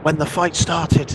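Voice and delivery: shouting to be heard in the wind; shouting over wind. Acted. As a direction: call it out over the storm. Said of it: "he's having to shout above that wind".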